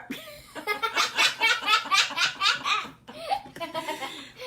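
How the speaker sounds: high burst of laughter; real laugh. Real, not acted. Teller laughing at her own sound effect. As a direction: crack up at the dropped dish. Storyteller laughs at dish drop.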